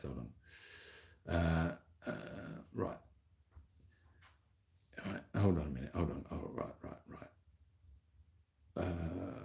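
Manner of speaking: breathy aside under his breath; muttered aside. Real, not acted. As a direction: mutter to yourself while fiddling with the phone. Fiddling with phone, muttering aside.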